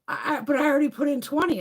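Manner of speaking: mock-panicked cashier stammering. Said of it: "panicked when I handed her the penny".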